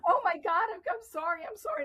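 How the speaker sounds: high, flustered, embarrassed apologies; flustered apology. Retold or imitated. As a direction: gasp out sorry in a panic. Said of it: "I went".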